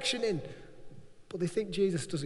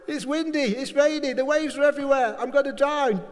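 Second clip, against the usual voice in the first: higher, louder frantic cry over the storm; panicked shouting; 5 semitones higher, 5 dB louder than usual. Acted. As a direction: yell like you're about to drown. Acted disciples panicking in storm.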